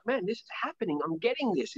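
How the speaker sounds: high, soft, excited inner voice. Retold or imitated. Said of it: "I was so excited, so happy".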